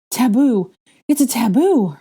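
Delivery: high, loud realization; shouted alarm. A narrator's reading. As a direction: yell the answer. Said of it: "Hermione cr-, shouted".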